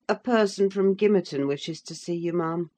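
plain reading voice

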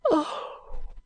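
high, hushed, breath-filled gasp; stricken, almost voiceless exclamation; shocked cry. A narrator's reading. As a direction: cry out softly in horrified grief. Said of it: "cried Ada".

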